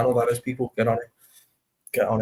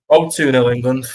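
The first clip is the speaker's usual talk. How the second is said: excited goal reaction; 8 dB louder than usual. Real, not acted. Reacting to England goal live.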